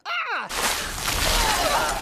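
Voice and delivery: high, loud startled shriek; mock shriek. Attackers splashed with gasoline, shrieking high and loud. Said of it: "they're like".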